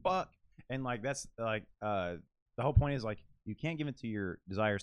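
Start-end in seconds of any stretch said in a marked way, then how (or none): 0.0-0.3 higher, louder startled curse; a hint of Jagan's shock; mock dismay. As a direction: caught off guard, hammed up. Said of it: "Jagan shows up and he's like".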